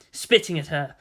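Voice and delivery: ordinary delivery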